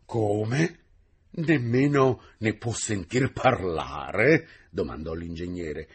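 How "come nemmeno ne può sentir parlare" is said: raised, louder, astonished protest; incredulous question. A narrator's reading. Engineer questions the indifferent teacher, incredulous. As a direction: stretch it out in disbelief.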